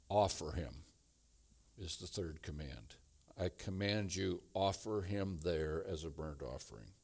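low, soft and grave; hushed solemn command. Acted. Preacher voicing the dreadful command to sacrifice, low and soft. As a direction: let it fall heavy and quiet.

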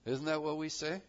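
ordinary talk

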